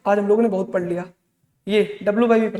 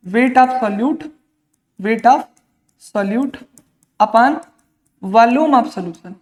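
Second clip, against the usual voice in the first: slow dictation; much slower than usual. Shown as a demonstration. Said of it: "teacher dictating formula slowly".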